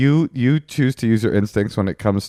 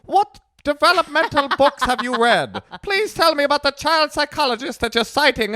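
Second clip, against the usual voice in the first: mock Scottish accent. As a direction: mimic partner as a prim Scot. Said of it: "I don't know why you became Scottish".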